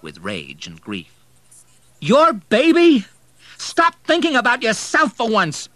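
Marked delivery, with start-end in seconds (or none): 2.0-5.7 enraged shout. A narrator's reading. Grieving son shouting at the drunk, very high furious yell. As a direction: scream it in rage and grief. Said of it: "he shouted".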